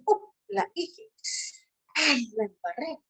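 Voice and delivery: high startled oh, then a guilty sigh; dismayed realization. Retold or imitated. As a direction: catch yourself out, dismayed. Catching herself in lie.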